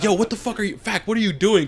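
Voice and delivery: retold confrontation. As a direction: act out Richie calling someone out. Acting out Richie's angry confrontation.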